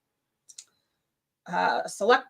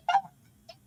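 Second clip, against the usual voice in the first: disbelief; 4 dB quieter than usual. Retold or imitated. Disbelief at breaking news.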